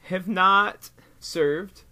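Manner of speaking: mock solemn statement, very slow with long pauses; drawn-out, hammed-up official apology; mock public apology. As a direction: play at a halting speech to the nation.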